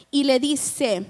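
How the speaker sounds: normal talking voice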